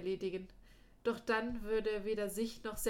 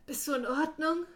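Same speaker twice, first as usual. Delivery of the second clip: hoarse, higher and louder question; 6 semitones higher, 7 dB louder than usual. Acted. Darren checking on his wounded friend, hoarse and raised. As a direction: rasp the worried question out, pitched up and pushed. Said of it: "fragte Darren mit heiserer Stimme".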